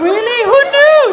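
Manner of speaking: high, loud shriek of amazement; hammed-up, shouted astonishment; astonished great-aunt. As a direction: marvel at it, voice leaping way up. Great aunt marvels at turtle news.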